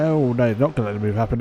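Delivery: normal talking voice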